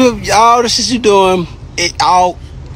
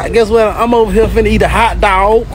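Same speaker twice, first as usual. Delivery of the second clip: ordinary talk, nothing marked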